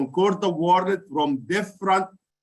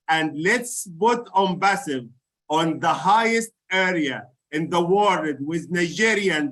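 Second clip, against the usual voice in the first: ordinary talk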